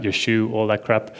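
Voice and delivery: usual speaking voice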